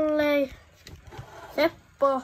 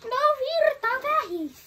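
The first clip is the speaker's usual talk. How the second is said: much higher, whiny complaint; pitched-up exasperated repeat; high-pitched protest; 9 semitones higher than usual. Real, not acted. Repeats battery warning to other child.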